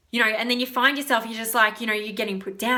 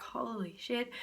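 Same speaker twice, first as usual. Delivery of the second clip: very soft, hushed disbelief; overwhelmed mutter; 13 dB quieter, slower than usual. Acted. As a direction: say it under your breath, overwhelmed. Overwhelmed reaction in an acted quote.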